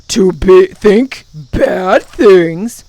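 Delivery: loud, very drawn-out creature voice; mocking echo. A narrator's reading. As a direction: repeat it slowly in loud mock disbelief. Gaboon echoes the girl mockingly.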